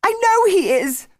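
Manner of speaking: high, loud, panicked cry; girl crying out that her brother is on the cliff; nearly hysterical cry. A narrator's reading. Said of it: "nearly hysterical".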